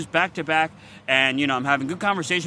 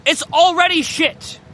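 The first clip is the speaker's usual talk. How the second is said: much higher, louder furious retort; angry outburst; 11 semitones higher, 6 dB louder than usual. Real, not acted. Answering the people who lecture him, furious. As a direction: snap back at the critics. Angry rant after mocking critics.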